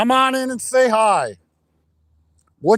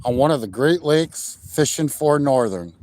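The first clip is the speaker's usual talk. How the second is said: deep announcer intro; 7 semitones lower than usual. Acted. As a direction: open the show like a deep-voiced outdoors host. Deep show-intro announcer opening.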